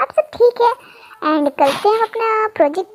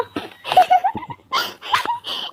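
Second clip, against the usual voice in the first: put-on a high, giggling laugh of a young girl; 14 semitones higher, 4 dB quieter than usual. Girl laughing at his dramatic complaint, high giggle.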